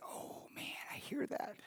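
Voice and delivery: breathy whisper, under the breath; hushed aside to yourself; whispered thought. Retold or imitated.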